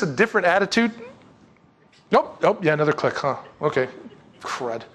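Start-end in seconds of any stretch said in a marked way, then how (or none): none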